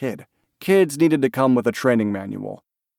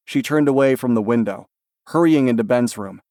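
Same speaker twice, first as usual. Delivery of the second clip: usual speaking voice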